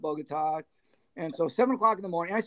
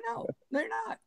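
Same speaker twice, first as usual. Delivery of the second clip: much higher, softer objection; retold protest; 7 dB quieter than usual. Manager stopping [name] from fleeing, high-pitched retold protest. Retold refusal to let bandmate leave.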